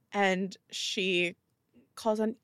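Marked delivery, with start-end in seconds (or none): none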